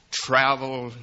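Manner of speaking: normal talking voice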